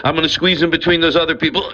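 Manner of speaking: ordinary delivery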